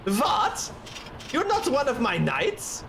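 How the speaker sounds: put-on high, affronted German-accented knight; Steiner. Captain realising they are impostors, indignant German accent. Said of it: "Steiner is just such a".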